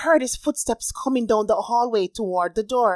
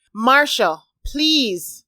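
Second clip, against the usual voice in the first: pleading call; 6 semitones higher, 4 dB louder, much slower than usual. A narrator's reading. Pleading through door.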